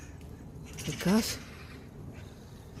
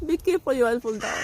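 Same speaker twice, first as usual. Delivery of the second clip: higher, anxious plea; worried warning; 6 semitones higher than usual. Real, not acted. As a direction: plead with her to be careful, higher. Warning cat in the tree.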